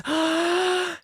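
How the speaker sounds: put-on sharp high gasp of a starstruck kid; imitated excited gasp. A kid spotting his basketball idol, excited gasp. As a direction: suck in air like a kid who just saw his hero. Kid spots Sean Kemp.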